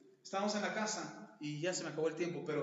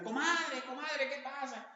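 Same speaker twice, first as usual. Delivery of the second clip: neighbor calling out; 7 semitones higher, slower than usual. Retold or imitated. Neighbor yelling to the fighting wife, high alarmed call. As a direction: call across the street to her friend, alarmed. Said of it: "le grita".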